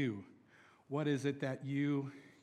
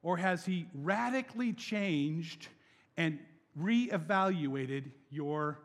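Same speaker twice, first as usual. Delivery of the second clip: ordinary delivery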